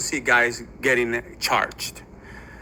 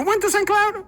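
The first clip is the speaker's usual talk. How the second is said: much higher, slowed-down astonished question; amazed question. Retold or imitated. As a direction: stretch it out in high disbelief. Lieutenant amazed at shared hometown.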